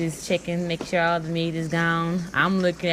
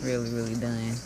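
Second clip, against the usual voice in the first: weary and defeated; 4 semitones lower, 5 dB quieter, slower than usual. Retold or imitated. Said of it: "He's just really just like".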